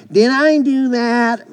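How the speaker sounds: high, drawn-out obnoxious nerd voice; Steve Urkel. Acted. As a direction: whine it slowly in a high, nerdy pitch. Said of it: "in a obnoxious voice".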